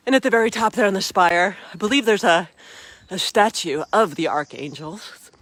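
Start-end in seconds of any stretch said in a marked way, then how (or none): none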